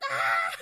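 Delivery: loud mock scream; imitated scream. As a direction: scream out, imitating someone being dragged off. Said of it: "Corbett is screaming".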